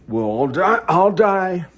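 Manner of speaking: a sudden high, loud cry over dying; dramatic outcry. Real, not acted. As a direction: cry it out in despair. Dramatic outburst about dying.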